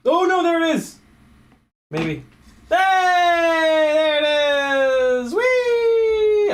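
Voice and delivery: high, loud yelp of joy; excited shout. Real, not acted. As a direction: cheer it out, thrilled. Finds lost clip, yells.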